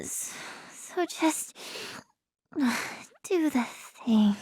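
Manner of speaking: slow, halting, soft and breaking off; fading out. Acted. Telling uncle they can handle it, fading out. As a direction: trail away as if losing consciousness. Losing the thread, fading out mid-sentence.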